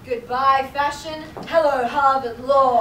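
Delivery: loud, slow declaration; triumphant. Acted. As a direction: announce it like a movie heroine. Legally Blonde moment.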